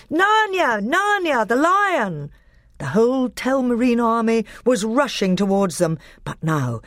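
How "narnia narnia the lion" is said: very high, ringing war cry; battle cry. A narrator's reading. Edmund at Peter's side as armies rush, high battle cry. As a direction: cry out to rally the troops.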